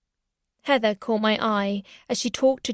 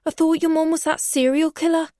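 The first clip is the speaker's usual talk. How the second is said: higher, mildly probing barman; Louis voice; 7 semitones higher, 3 dB louder than usual. A narrator's reading. Barman Louis prodding about her serial killer mum. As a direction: needle her with a light tone. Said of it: "said Louis".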